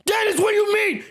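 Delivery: high, exasperated shout; getting mad. Real, not acted. Host answering a chat comment, shouting in exasperation. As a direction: snap back at the chat. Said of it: "Now I'm getting mad".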